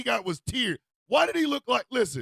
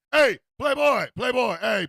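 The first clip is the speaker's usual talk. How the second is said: loud, raised call-out; acted street holler; 3 semitones higher, 6 dB louder than usual. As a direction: shout for his attention in short, falling bursts. Acting out calling someone out.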